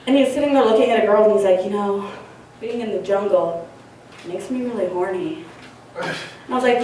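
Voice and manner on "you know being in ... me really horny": hushed, low movie-line murmur; Owen Wilson. Retold or imitated. Actor confides to a girl in the jungle, quiet and low. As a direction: drop it soft and low with pauses between phrases. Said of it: "Owen Wilson says it".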